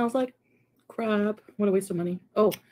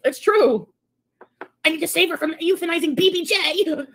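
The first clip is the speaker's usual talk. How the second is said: high mocking sing-song impression; 9 semitones higher, 4 dB louder than usual. Streamer mocking a rival's claim, high mock voice. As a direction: mimic her smugly, higher.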